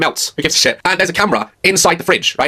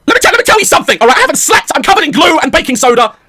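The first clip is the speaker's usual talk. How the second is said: much higher, louder ranting; exasperated outburst; 9 semitones higher, 3 dB louder than usual. Retold or imitated. Sleepless dad ranting at the teacher, high and loud. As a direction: vent at the teacher, fed up. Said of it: "I'm like".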